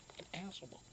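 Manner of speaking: muttered under breath. Real, not acted. Swearing under his breath after being yelled at. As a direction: grumble it to yourself, dropped way down.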